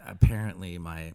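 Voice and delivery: ordinary delivery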